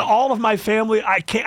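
usual speaking voice

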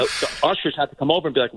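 ordinary talk, nothing marked

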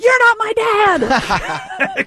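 put-on high, slow, laughing teen sass; sulky teen voice. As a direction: whine it like a bratty kid. Voicing the suitcase as teen.

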